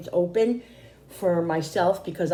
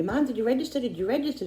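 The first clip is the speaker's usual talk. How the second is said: son's pestering question, pitched higher; nagging son; 6 semitones higher, faster than usual. Retold or imitated. Son nagging his mom to sign up, pitched-up mock pester. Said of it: "my son kept saying to me".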